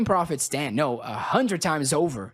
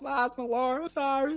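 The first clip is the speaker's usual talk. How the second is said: sheepish apology; 9 semitones higher, 5 dB quieter than usual. Acted. Knight apologizes to his lord.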